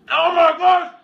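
loud, high, fast plea for water; urgent shout. Real, not acted. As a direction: beg desperately through the burn. Mouth burning, begging for water.

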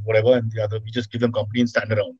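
ordinary talk, nothing marked

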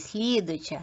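usual speaking voice

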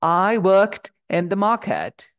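británico muy lento y fuerte, sin R; acento británico. Shown as a demonstration. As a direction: dilo en británico alargando las vocales. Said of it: "Lo voy a pronunciar en británico". (inglés británico proyectado, R mudas)

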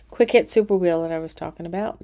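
ordinary talk, nothing marked

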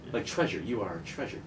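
mishearing it softly as treasure; quiet, low, amused mishearing; soft, low aside. Pretend. Host softly mishears the phrase.

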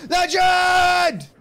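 high, loud yell of one word; yelled legend. Real, not acted. Streamer's random yell for a donor, loud shout. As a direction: belt it out like a cheer. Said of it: "I yelled legend".

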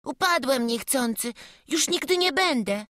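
put-on high, frightened little boy pleading; stammering boy. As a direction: stammer an apology as a scared child. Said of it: "wyjąkał malec".

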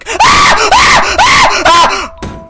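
very high, loud repeated screams; terrified screaming. Real, not acted. Player startled by banging on the door, screaming. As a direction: scream over and over in fright.

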